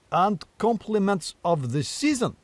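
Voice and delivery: high, slow and dignified; a grand old stag greeting; stately deer voice. Acted.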